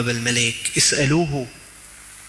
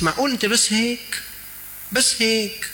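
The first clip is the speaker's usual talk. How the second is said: high-pitched, disbelieving question; incredulous challenge; 10 semitones higher than usual. Real, not acted. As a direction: question them in rising disbelief. Preacher challenges listeners' narrow prayers. (باستنكار وتعجّب)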